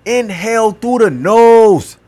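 breathing instruction. Shown as a demonstration. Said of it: "do it with me".

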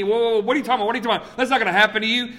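high, rushed objection; alarmed protest. Retold or imitated. Disciple cutting in on Jesus about betrayal, alarmed protest.

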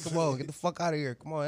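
yelling at dogs. Retold or imitated. Man shooing little dogs off the bed: loud yell. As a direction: bark them off the bed, half laughing.

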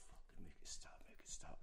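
breathy pleading whisper, begging it to stop; desperate whisper. Retold or imitated. Tripping on the floor, begging the shaman to stop: whispered plea. Begging during intense trip.

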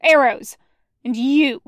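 loud, curt commands; barked orders. Acted. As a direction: bark orders in battle. Commanding boys during dog attack.

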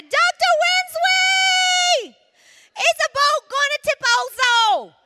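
put-on high, very loud child's scream, slow and drawn out; screaming scared toddler. Little Ross screaming to the doctor, terrified child shriek. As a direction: wail it like a terrified little boy. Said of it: "he began to scream and cry this".

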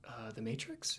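soft, tentative question as Neo; hushed, innocent little query. Retold or imitated. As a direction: ask it quietly, innocently unsure. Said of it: "this kinda innocent questioning voice".

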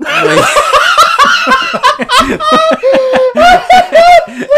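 high shrieking laugh close to a scream; hysterical shrieking laugh. Real, not acted. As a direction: burst into a wild shrieking laugh. Recalling nightmares, laughing hysterically.

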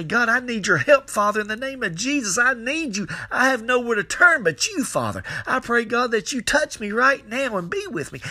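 high, urgent pleading prayer; crying out to God. Acted. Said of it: "got into the crying out".

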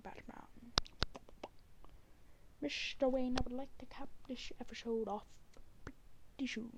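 hushed whispering butler voice; Alfred the butler. Pretend. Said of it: "my weird Alfred slash Jim Carrey impression".

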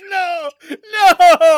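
loud, high disbelieving cry; shocked shout. Real, not acted. Disbelief at Ian Mellencamp.

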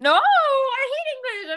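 loud, high drawn-out wail of protest; whiny outcry, slow and high; exasperated protest. Real, not acted. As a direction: stretch out a pleading, exasperated no. Protesting friend's reassurance.

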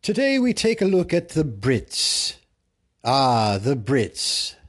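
high, booming British presenter; hammed-up British announcing, loud and grand; mock British host. Host presenting a look at the Brits, mock British.

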